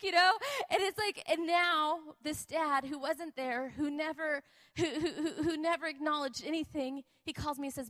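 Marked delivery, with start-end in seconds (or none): none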